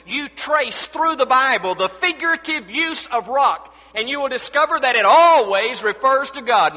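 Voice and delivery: forceful pulpit preaching with weighted key words. Real, not acted. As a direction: preach it out to the whole congregation.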